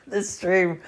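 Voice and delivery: real laugh breaking into the words; chuckling at his own bit. Real, not acted. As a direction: laugh through the start of the line.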